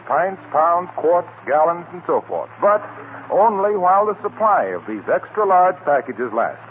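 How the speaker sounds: ordinary talk, nothing marked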